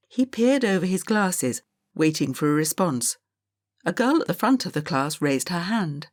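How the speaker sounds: plain reading voice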